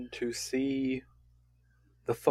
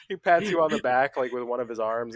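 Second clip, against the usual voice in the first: ordinary talk, nothing marked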